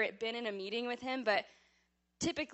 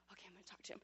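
hushed whisper to herself; 22 dB quieter, much faster than usual. Retold or imitated. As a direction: whisper to steel your nerve. Psyching herself up in secret.

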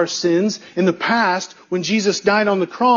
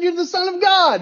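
imitated scream; 9 semitones higher than usual. Preacher repeating what the dude just said, mock scream. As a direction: quote the man's scream at raised pitch. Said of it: "he starts screaming out".

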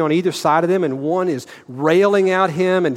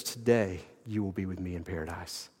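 soft, lower, tender promise; sweetest words; 8 semitones lower, 11 dB quieter than usual. Retold or imitated. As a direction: say it softer, lower, like a gentle promise. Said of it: "the sweetest words".

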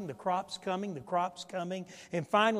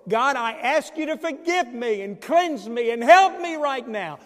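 fervent plea; 7 semitones higher, 11 dB louder than usual. Acted. Acted prayer of repentance.

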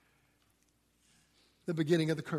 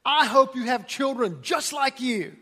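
mock exasperated parent, a little laugh; half-joking scold of a naughty child; a playful scolding parent; parent's curse line; 6 semitones higher, 6 dB louder than usual. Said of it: "you say something like this".

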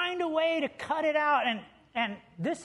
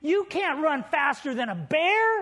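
exasperated Dwayne; 5 semitones higher, 4 dB louder than usual. Retold or imitated. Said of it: "He said".

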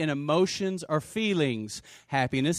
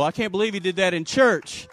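ordinary talk, nothing marked

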